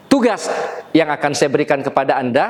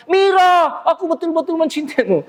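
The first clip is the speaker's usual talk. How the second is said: seruan teatrikal bernada sangat tinggi; suara panggung berlebihan; 11 semitones higher than usual. Shown as a demonstration. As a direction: nyatakan cinta seperti berdeklamasi di panggung. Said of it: "tidak wajar kalau di luar panggung". (gaya panggung yang tidak wajar, tinggi dan dilebih-lebihkan)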